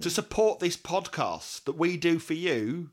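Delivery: high, loud, slow plea; hammed-up sales pitch. Host selling the podcast to listeners, hammed up. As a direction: spell out the bargain emphatically. Mock-amazed pitch for the Patreon.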